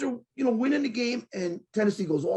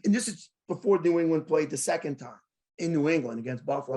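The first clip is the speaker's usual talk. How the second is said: ordinary talk, nothing marked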